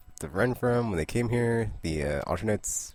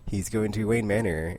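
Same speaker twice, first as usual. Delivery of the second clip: normal talking voice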